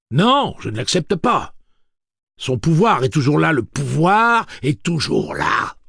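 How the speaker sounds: high, loud desperate yell; desperate shout. Acted. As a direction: insist with all your force. Eddie desperate insistence.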